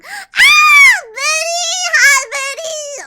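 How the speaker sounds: high, loud, cracking excited cheer; excited greeting. Real, not acted. Greeting Betty in chat, voice cracking with excitement. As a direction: squeal a hello to a friend. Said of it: "my voice cracks so much".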